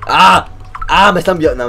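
usual speaking voice

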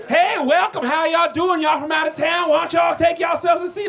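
a hammed-up friendly Southern welcome; mock Southern hostess drawl. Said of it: "be all Southern drawly".

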